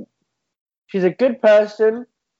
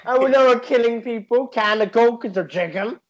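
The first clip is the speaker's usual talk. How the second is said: raised Scouse speech voice; rally speech in a Scouse accent; 4 semitones higher, 3 dB louder than usual. Pretend. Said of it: "do a Scouse accent".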